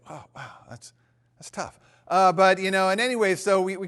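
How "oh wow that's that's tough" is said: very soft, halting reaction; hushed, awkward acknowledgement; awkward sympathy. Retold or imitated. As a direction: back off quietly, at a loss for words. Awkward reaction to nine-year admission.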